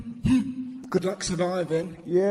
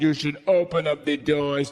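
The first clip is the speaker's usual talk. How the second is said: ordinary talk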